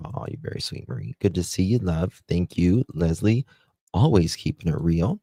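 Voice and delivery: ordinary talk, nothing marked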